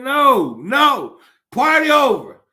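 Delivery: a hammed-up angry halt; loud mock refusal. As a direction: shut the party down loudly. Acting out stopping the party.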